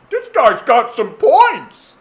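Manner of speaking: high, loud, slow mock fool; mocking dupe voice. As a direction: drag it out high and loud like a gullible reader. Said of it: "being like".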